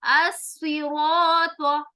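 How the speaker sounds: अस्सिरात very slow, with a dark heavy r and aa; heavy Arabic as-siraat. Shown as a demonstration. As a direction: stretch अस्सिरात with full tafkheem. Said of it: "फिर उसी तरह देखें".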